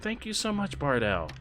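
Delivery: normal talking voice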